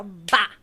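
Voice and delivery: loud "bah" for a body hitting the floor; one loud crash noise; imitated thud. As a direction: imitate a stiff, sudden fall. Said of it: "Aí eu caí dura".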